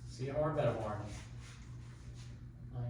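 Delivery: ordinary talk, nothing marked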